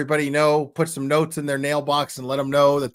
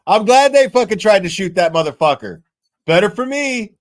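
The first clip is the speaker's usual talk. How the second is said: loud, high hammed-up gloating; mock president rant; 8 semitones higher, 10 dB louder than usual. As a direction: yell it like a gleeful villain. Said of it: "no president's gonna stand up there and be like".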